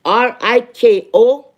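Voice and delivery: slow, letter by letter; each letter said on its own, slowly; spelling it out. Shown as a demonstration. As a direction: spell the name out deliberately. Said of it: "let me spell the fucking name".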